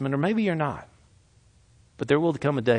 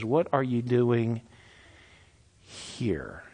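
slow, sinking lower and softer on here; slow soft question; 3 semitones lower, 5 dB quieter, much slower than usual. Acted. God's still small voice asking Elijah, slow hush. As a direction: let it drop to a hush.

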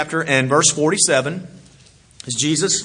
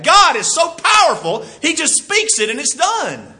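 preaching shout; 11 semitones higher than usual. Real, not acted. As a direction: cry out God's power to the congregation. Preacher exclaiming God's power.

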